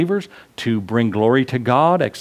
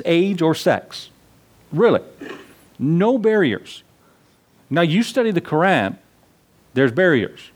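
high, emphatic preaching voice; impassioned pulpit delivery; preaching at full force; 5 semitones higher than usual. Acted. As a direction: drive the point home to the congregation. Preacher builds on open grace.

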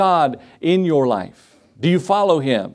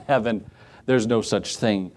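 mocking laugh; 6 semitones lower, 5 dB quieter than usual. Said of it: "She started making fun of her".

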